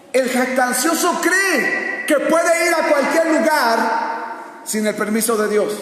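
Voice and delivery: high, slow preacher's cry; forceful preaching. Real, not acted. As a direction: hammer it out to the congregation.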